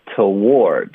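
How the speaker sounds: "toward" drawn out as a reduced t'- then a long WARD; weak first syllable. Shown as a demonstration. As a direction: slowly model "toward", stressing the second syllable. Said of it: "一般就发T就可以了".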